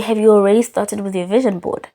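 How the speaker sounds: ordinary talk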